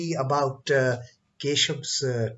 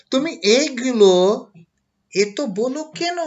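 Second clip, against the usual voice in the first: retold challenge; 9 semitones higher, 9 dB louder, much faster than usual. Ramakrishna retold questioning Keshav.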